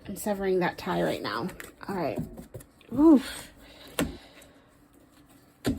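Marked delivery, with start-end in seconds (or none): 3.0-3.5 loud, high exhale of effort; sudden loud puff; sharp "oof". Real, not acted. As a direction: let out a big high "oof". Relief after severing the spirit tie.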